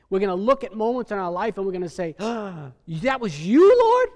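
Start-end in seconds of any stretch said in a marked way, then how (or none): 2.2-4.1 a rising, loud, wondering "Lord?" at the top; amazed realization. Pretend. The faithful in heaven marveling to the Lord, loud and rising. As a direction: let it dawn on you, swooping up high.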